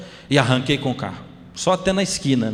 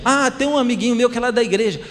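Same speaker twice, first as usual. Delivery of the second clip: put-on child voice; 9 semitones higher than usual. Son answers father in car.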